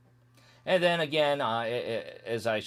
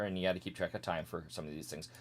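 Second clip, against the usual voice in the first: usual speaking voice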